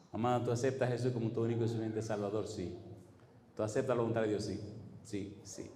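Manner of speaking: soft, hushed deathbed questions; tender. Retold or imitated. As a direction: ask gently at the bedside.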